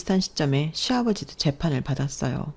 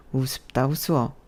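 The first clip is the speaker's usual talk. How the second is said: scornful laugh; 3 semitones lower, 5 dB quieter than usual. Acted.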